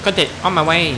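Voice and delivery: mimicking game character. Player echoes the game character's reply, mimicking.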